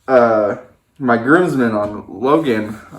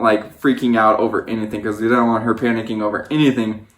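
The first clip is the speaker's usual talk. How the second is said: normal talking voice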